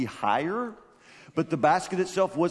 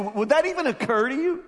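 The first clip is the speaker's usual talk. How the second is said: ordinary talk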